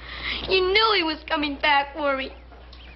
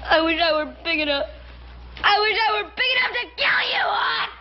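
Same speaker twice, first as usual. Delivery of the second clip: put-on screaming child. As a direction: scream it through tears. Boy screams at uncle.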